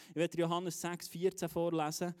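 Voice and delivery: ordinary delivery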